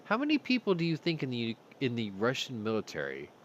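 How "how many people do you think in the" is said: ordinary talk, nothing marked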